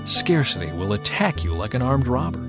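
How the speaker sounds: normal talking voice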